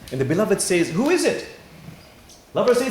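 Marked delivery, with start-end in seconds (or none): 0.9-1.5 high, loud call through a door. Retold or imitated. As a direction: call out the question, raised and loud. Beloved calls through the door.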